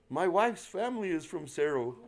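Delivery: amazed by the coincidence, laughing; excited stranger. Retold or imitated. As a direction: stranger laughing as he shares the link.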